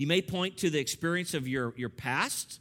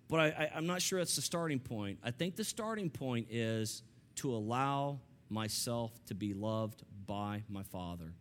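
ordinary delivery

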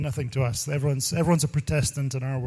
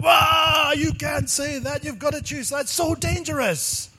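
mock shouting; 12 semitones higher, slower than usual. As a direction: ham up the outraged shout, stretched out. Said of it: "I was like".

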